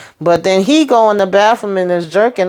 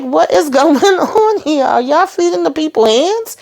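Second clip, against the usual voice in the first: incredulous; 9 semitones higher than usual. Retold or imitated. Viewer reacting to hands being served as food, incredulous. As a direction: question it in a high, astonished voice.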